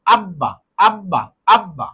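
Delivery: আব্বা with the ব held double, as আব-বা; doubled consonant. Shown as a demonstration. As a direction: say আব্বা slowly, pressing the doubled ব. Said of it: "ওই হরফটা দুইবার পড়তে হবে". (আব্বা, ব দুইবার চেপে ধীরে: আব-বা)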